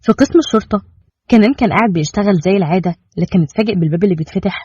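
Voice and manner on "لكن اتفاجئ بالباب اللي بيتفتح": ordinary delivery, nothing marked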